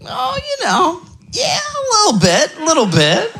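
very high, squeaky hedging; tiny high voice, hedging the answer; sheepish bluff. Acted. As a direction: squeak out an unconvincing fib in falsetto. Bluffing about speaking German.

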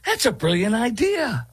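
high-pitched sarcastic gushing; mock enthusiasm. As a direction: gush in a silly high voice, mocking the idea. Mock praise of the played proposal.

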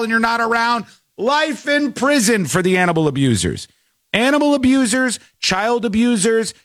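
high-pitched, insistent tirade; indignant rant. Real, not acted. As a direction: lay into the abusers, pitch held up high. Rant against animal abusers.

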